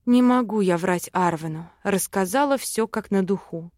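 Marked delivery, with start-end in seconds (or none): none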